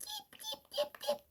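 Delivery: high, soft peeping; chick peeps. Shown as a demonstration. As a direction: peep like tiny chicks. Reading animal sounds book.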